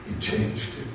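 softly repeated, almost whispered; hushed repeat. A narrator's reading. Narrator repeats it in a hushed voice. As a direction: drop to a breathy aside.

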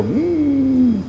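high, drawn-out "mmm"; children's refusing hum. Retold or imitated. Children asked to take medicine, high refusing hum. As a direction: imitate kids humming a no to medicine. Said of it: "they all go".

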